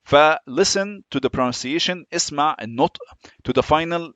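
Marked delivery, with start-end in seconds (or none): none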